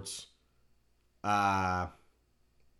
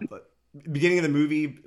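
usual speaking voice

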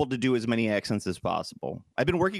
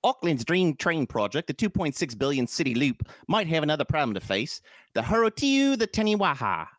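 reading aloud in a put-on New Zealand accent; New Zealand newsreader, pitch climbing on the place names; 3 semitones higher than usual. Host reading a baffling article, mock New Zealand accent. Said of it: "my New Zealand is just my Australian".

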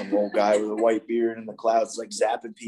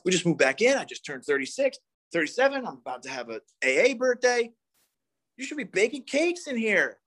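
higher, indignant mock complaining; higher indignant grumble of a boyfriend who feels owed a cake; selfish ego rant; 8 semitones higher than usual. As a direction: voice the petty, entitled side of yourself, pitched up. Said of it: "the selfish part of me wants to be like".

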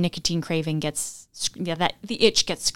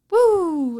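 high, bright woo for the puppy; excited cheer; 9 semitones higher, 3 dB louder than usual. Pretend. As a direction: cheer like praising a dog. Praising puppy, +9 semitones.

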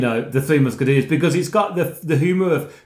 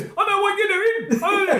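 imitating the owner; 13 semitones higher than usual. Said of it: "the owner goes".

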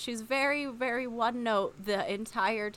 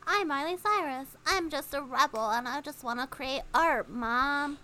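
put-on higher, whiny rebel teen voice; mock [name]; 4 semitones higher than usual. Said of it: "And then it was like".

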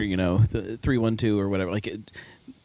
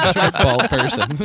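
laughing; 8 semitones higher, 5 dB louder, slower than usual. Real, not acted. Laughing at a joke.

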